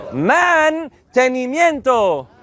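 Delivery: Mantenimiento drawn out slowly; slow model word. Shown as a demonstration. Teacher modeling for the class, slow and clear. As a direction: take Mantenimiento syllable by syllable. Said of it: "teacher slow model".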